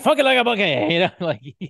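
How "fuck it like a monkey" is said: high, loud lisping Southern promo voice; Dusty Rhodes impression. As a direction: shout it like a lisping wrestler. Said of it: "I can kinda do a Dusty".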